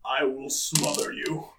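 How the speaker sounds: a quiet threat from a dark god; dark lord. Acted.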